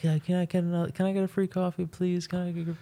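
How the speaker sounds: high-pitched whiny begging, a mock yell without volume; a friend mimics a pushy stranger begging for coffee, high and whiny; imitating a pestering woman. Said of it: "goes up to the manager up front, like".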